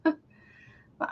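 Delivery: slow, said through a yawn. Real, not acted. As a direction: talk while stretching a yawn. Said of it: "I'm yawning so much".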